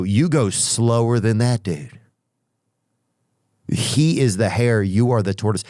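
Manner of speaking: ordinary talk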